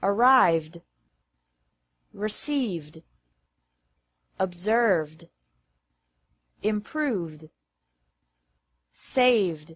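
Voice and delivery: "Arrived, received, observed, improved, saved" with a voiced D ending. Shown as a demonstration. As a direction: end each word on a gentle D. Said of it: "pronounced like D after verbs".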